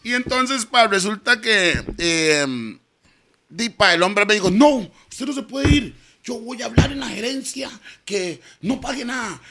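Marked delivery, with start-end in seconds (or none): 4.5-9.4 high-pitched, insistent retold promise; emphatic insistence. Man says he'll talk to management, emphatic insistence. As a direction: act out a man firmly stepping in. Acted quote of the man intervening.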